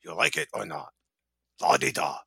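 a hint of Pacino after fifty years of smoking; imitating raspy Pacino. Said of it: "he sounded like he was smoking for fifty years".

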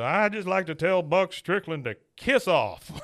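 mock-drunk defiance; drunk Hank. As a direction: play him buzzed and bold. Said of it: "he goes".

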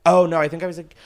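ordinary talk, nothing marked

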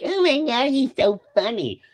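high-pitched squeal of a clueless fan; mock clueless voice. Host mimics people who missed the point, mocking high voice. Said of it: "I made that voice that way".

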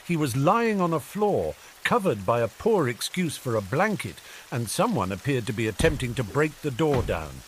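ordinary delivery

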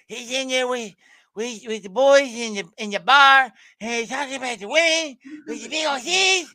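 high, loud cartoonish character voice; movie kid impression. Retelling a Weird Science scene, high cartoon voice. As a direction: squeeze it high, push it loud. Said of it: "that is, that is Cartman".

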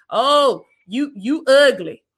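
mocking inner voice. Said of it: "that voice in your head talking about".

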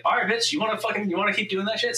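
high-pitched taunting challenge; mock self-scolding. Acted scolding of his own mind.

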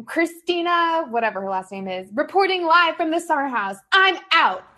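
high, loud reporter sign-off. Retold or imitated. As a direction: announce it like a live TV reporter. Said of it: "and she's like".

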